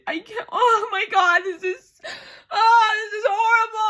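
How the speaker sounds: rising from stunned words to high wail; overwhelmed wailing. Real, not acted. Reader unable to comprehend the passage, building wail. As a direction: build to a melodramatic, loud whine.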